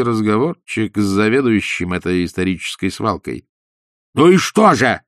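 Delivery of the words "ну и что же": high, loud impatient shout. A narrator's reading. Ippolit shouts at Ostap for news, impatient. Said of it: "закричал Ипполит Матвеевич".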